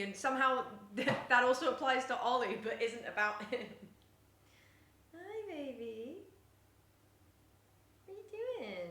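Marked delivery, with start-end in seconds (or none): 5.1-8.9 soft, slow, high coo to the cat; soft pet talk. Real, not acted. Owner softly greets her cat Pochi, slow tender coo.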